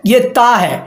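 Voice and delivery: normal talking voice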